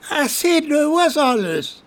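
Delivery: put-on high, slow affronted drunk. Colm recoiling at the soldier, high and affronted. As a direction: recoil in offended surprise. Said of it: "Colm recoiled, not a little affronted".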